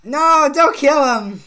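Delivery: drawn-out loud shout of no, slowed right down; desperate shout. Real, not acted. Gamer yelling at the enemy not to kill his teammate, desperate shout. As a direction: yell it at the screen as the enemy closes in.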